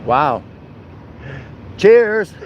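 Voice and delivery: ordinary talk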